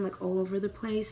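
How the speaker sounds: ordinary delivery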